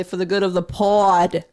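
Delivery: a playful put-on Boston accent on "pod"; mock Boston accent. Shown as a demonstration. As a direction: tease with a hammed-up Boston "pod". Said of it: "It's just an accent".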